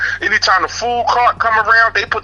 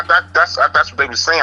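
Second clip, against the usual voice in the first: normal talking voice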